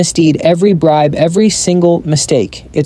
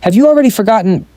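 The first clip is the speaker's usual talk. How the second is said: higher, quick interrupting question; cutting him off; 7 semitones higher, faster than usual. A narrator's reading. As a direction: cut in sharply with a challenge. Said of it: "Gal cuts him off".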